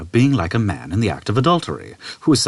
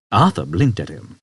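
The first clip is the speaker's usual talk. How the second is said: usual speaking voice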